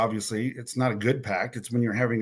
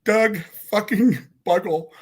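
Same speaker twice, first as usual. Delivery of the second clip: high, strained voice near tears; choked up; 6 semitones higher than usual. Real, not acted. Teller confessing he wrecked two families, choked up. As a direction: push on through real upset. Teller overcome, recalling betrayed best friend.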